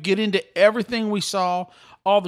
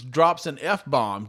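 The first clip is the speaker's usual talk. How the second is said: ordinary delivery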